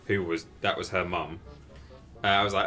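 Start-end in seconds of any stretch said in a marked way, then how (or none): none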